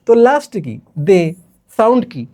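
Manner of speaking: ordinary delivery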